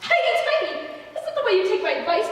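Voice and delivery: shrill high outrage; outraged exclamation. Acted. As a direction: squawk in disbelief. Aunt outraged by defiance.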